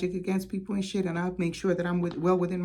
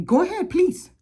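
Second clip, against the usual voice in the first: much higher, louder "go ahead, please", taunting; defiant dare; 12 semitones higher, 6 dB louder than usual. Retold or imitated. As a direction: dare her to call the police. Daring her to call police.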